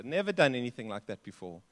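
usual speaking voice

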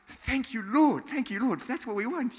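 high, elated thanks to God; delighted gratitude. Acted. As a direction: welcome a keen recruit gladly. Acting a delighted church leader's response.

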